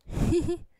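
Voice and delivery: short high-pitched giggle; little giggle. Acted. Clingy sister enjoying her brother's hug, high giggle. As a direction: giggle in quick high bursts.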